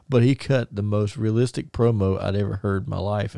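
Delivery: ordinary delivery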